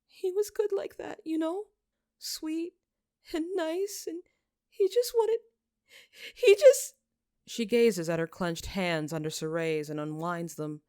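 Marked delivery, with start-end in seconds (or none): 0.1-6.9 high and tearful, building to a loud breaking wail; grieving girl, rising to a loud sobbing break; breaking into tears. Acted. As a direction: she cracks apart mid-sentence. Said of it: "She breaks".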